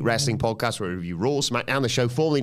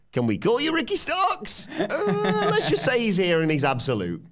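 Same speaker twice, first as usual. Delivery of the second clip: laughing mock quote; 7 semitones higher than usual. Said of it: "They were like".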